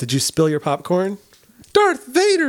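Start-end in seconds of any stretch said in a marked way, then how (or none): 1.7-2.5 mock dramatic. Playful gasp at movie twist.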